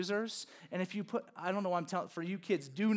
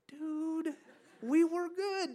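exasperated; 8 semitones higher, much slower than usual. Retold or imitated. Friends staring at the honest friend.

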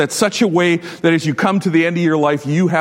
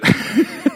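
breathy disbelieving laugh; 11 semitones higher than usual. Real, not acted. Laughs at boss's excuse.

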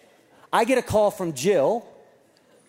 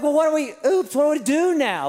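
higher-pitched retold disbelief; exasperated parent; 6 semitones higher than usual. Dad questioning doctor after the cast mistake, exasperated. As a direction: demand answers, thrown by the blunder.

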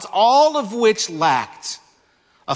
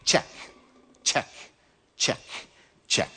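mental box-ticking; 9 dB quieter than usual. Acted. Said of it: "picture this man mentally saying".

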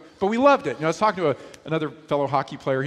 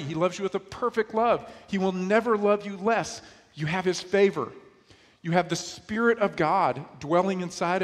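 raised, fervent preaching; rising pulpit assurance; 5 semitones higher than usual. Acted. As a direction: lift them up with conviction. Preaching climax.

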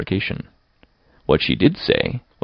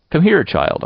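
put-on "Come here, child" high, falling at the end; high, insistent command; insistent summons; 8 semitones higher than usual. A narrator's reading. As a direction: order the child over, impatiently.

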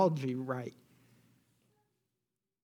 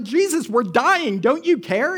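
panicked cry; 7 semitones higher, 8 dB louder, slower than usual. Acted. Panicked disciples waking Jesus.

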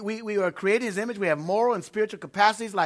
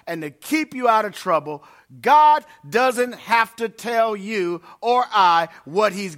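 louder, emphatic preaching, leaning into each "only"; 3 semitones higher, 6 dB louder, slower than usual. Acted. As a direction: drive it home like a pastor from the pulpit. Preaching with rising force.

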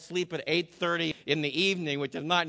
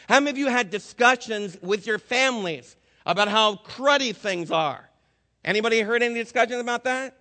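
rally the congregation from the pulpit; preaching; 4 semitones higher than usual. Acted. Preacher rising to full force.

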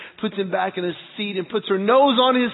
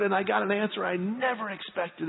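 ordinary talk, nothing marked

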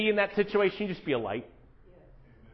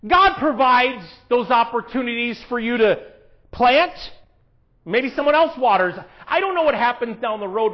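full-force preaching; 6 semitones higher, 6 dB louder than usual. Acted.

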